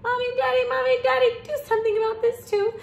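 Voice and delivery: put-on high, rapid-fire child's wail; urgent high-pitched complaint; yelled plea. A narrator's reading. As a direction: call both parents in a high, loud kid voice. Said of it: "He took a bite and yelled".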